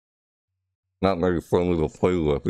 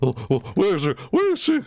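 mock panic; 9 semitones higher, faster than usual. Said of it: "It's like".

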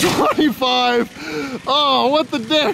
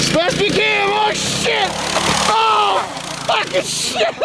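high yelps tipping into laughter; excited outburst; 11 semitones higher, slower than usual. Real, not acted. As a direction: react to the crash, high and thrilled. Reacting to a crash, then laughing.

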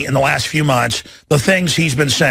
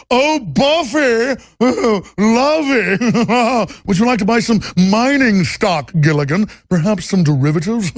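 hammed-up British-tinged snob with chuckles; Thurston Howell the third; 3 semitones higher than usual. Rich man offering Buffy mining stock, affected chuckling voice. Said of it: "Thurston Howell the third".

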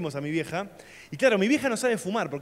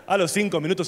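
ordinary delivery

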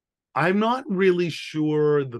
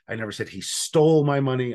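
"stole" punched louder; stress on stole; 3 dB louder than usual. Shown as a demonstration. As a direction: hit "stole" hard and stretch it. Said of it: "depending on which word you emphasize".

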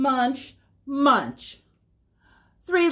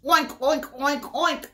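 pig oinks; 4 semitones higher than usual. Acted. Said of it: "said the pig".

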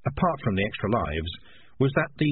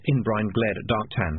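usual speaking voice

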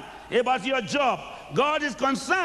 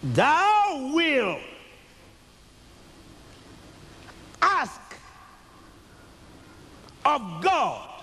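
preaching scripture; 2 semitones higher, much slower than usual. Acted. Preacher quoting scripture with force.

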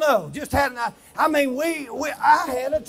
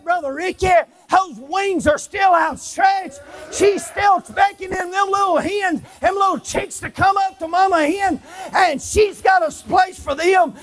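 preaching; 6 semitones higher, 5 dB louder than usual. Acted. Preaching at full force.

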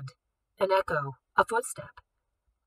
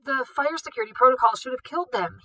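angry director; 5 semitones higher, 4 dB louder than usual. Acted.

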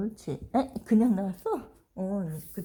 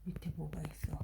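whispering; 4 semitones lower, 10 dB quieter, faster than usual. Real, not acted. Peeking at dog, whispering.

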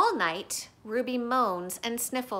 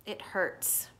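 whispered, soft and breathy; hushed pain; 2 semitones lower, 7 dB quieter than usual. A narrator's reading. Narrating Ivan's hurt in a whisper. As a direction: let it slip out in a whisper. Ivan lying on cold cement.

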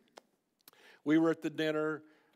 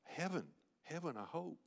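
hushed, hesitant retold reply with a slight quiver; 2 semitones higher, 9 dB quieter than usual. Bike driver answers where he's going after death, quivering softly. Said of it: "real, with a quivering voice".

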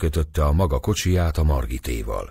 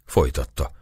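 ordinary delivery, nothing marked

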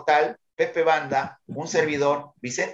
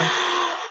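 choked cry. Real, not acted. Overcome as the crowd cheers.

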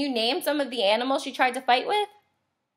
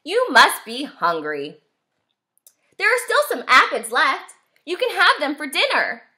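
friendly ladybug; 5 semitones higher, 4 dB louder than usual. A narrator's reading. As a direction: greet her kindly, lightly voiced. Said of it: "said the friendly ladybug".

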